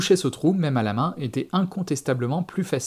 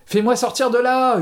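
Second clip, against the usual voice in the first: high, loud desperate yell; panicked, full-voiced cry; screaming; 11 semitones higher, 5 dB louder than usual. A narrator's reading. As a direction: scream to be let out. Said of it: "hurla-t-il".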